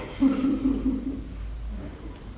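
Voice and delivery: quiet, high, drawn-out chuckle; a long run of soft, high laughter. Acted. Laughs mid-monologue about his beard.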